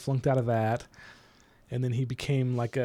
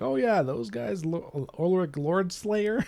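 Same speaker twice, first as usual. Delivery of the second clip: drawn-out, higher offhand villain voice, slow; casual villain; 6 semitones higher, slower than usual. Retold or imitated. The middle father casually naming Ulrich Lord Slayer, slow and higher. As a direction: toss the name out slowly like it's nothing. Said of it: "He's like".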